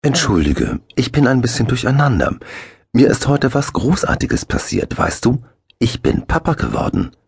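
new father Axel telling Eddie, giddy; flustered joy. A narrator's reading. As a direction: spill the happy news breathlessly.

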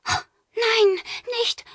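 put-on high, alarmed girl's cry. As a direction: stop him in alarm. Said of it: "Gerda riss die Augen auf".

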